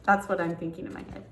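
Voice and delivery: ordinary delivery, nothing marked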